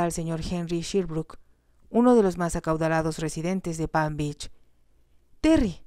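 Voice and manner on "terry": high, loud cry of his name; anguished cry. A narrator's reading. Jocelyn cries out for Terry in horror. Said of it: "gritó Jocelyn".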